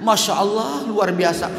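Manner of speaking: put-on high-pitched lament of an old woman; retold weeping. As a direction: imitate someone overcome to tears. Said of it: "tergeletak dia nangis". (meratap, menirukan orang menangis)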